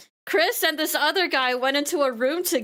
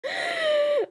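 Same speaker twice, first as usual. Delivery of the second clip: shrieking laugh. Real, not acted. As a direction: let the laugh break out into a shriek. Bursts out after her punchline.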